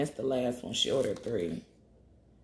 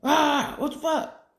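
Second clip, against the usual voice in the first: sudden high yelled outburst, a pitch jump far above her usual; mock freakout yell; 12 semitones higher, 6 dB louder, slower than usual. Retelling her own panic, a high loud mock yell. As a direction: blow up like a dinosaur losing it. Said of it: "I'm like".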